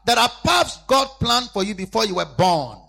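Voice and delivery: loud, slow, high preaching cry; loud pulpit cry, drawn out; preaching shout. Acted. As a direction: proclaim it at full force. Preaching at full force.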